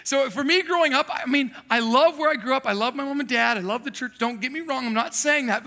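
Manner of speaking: ordinary talk, nothing marked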